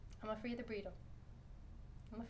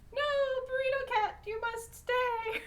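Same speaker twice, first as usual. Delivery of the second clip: playful mock command; 8 semitones higher than usual. Real, not acted. Owner telling the wrapped cat to stay, playful mock command. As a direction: order the pet to stay in a silly high voice.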